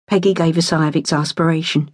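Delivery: ordinary delivery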